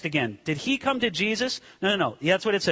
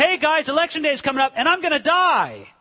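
mock campaign announcement; 6 semitones higher, 4 dB louder than usual.

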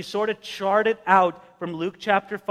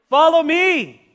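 firm call; 8 semitones higher, 6 dB louder, slower than usual. Acted. Jesus calls Levi.

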